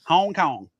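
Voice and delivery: "Hong Kong" with a heavily drawn-out diphthong in each vowel; overdone vowel glides on "Hong Kong"; exaggerated diphthong. Shown as a demonstration. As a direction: really lean on the gliding vowels in "Hong Kong". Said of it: "You gotta really put that diphthong in there".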